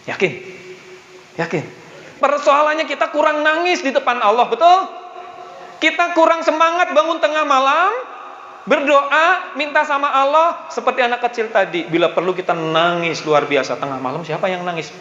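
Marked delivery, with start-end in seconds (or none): 2.2-11.9 high-pitched, driving sermon delivery; fervent preaching. Acted. Preacher urging the congregation to ask God boldly, fervent preaching. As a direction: preach it urgently, voice pitched well up.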